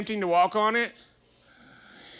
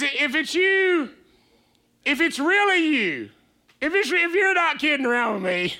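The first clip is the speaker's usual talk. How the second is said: pleading call; 6 semitones higher than usual. Acted. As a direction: call out across the waves, begging for proof. Peter calling out to Jesus.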